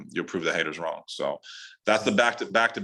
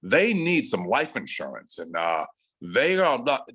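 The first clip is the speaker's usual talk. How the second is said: slow, drawn-out put-on salesman voice; old Midwestern salesman; slower than usual.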